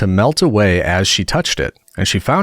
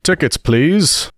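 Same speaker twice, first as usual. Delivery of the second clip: drawn-out, higher guard's call; lightly voiced train guard calling for tickets; 6 semitones higher, much slower than usual. A narrator's reading. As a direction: stretch it out like a ticket inspector. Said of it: "said the guard".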